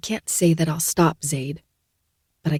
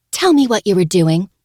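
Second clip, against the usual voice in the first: loud, high demand; 4 semitones higher, 5 dB louder than usual. Acted. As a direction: demand it firmly. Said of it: "she demands".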